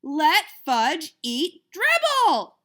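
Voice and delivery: loud, slow, high scream; boy screaming at his mother about the turtle. Acted. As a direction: scream it at Mom. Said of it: "I screamed".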